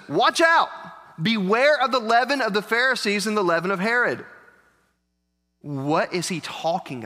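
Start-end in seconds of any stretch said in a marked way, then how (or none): 0.1-4.2 urgent warning. Acted. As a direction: cry out the warning as Jesus. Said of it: "And Jesus says".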